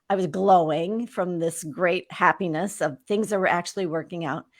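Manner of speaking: ordinary talk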